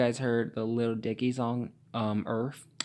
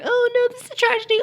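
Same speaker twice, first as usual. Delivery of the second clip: very high, loud fake horror; hammed-up shocked falsetto; mock shocked; 6 dB louder, faster than usual. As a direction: mimic people gasping in shock. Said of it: "looking all shocked".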